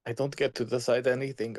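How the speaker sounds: loud and bitter; angry outburst. Acted. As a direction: throw it back, loudly. Heated quarrel, bitter reply.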